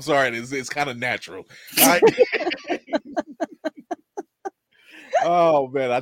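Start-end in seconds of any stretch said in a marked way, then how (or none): 2.0-3.9 high evil cackle; villain laugh. Real, not acted. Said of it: "the villain laugh".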